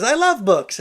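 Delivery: high-pitched mock gushing; giddy. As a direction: gush like a delighted bookworm. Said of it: "gets all giddy and says".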